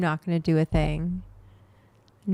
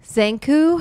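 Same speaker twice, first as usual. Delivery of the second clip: ordinary talk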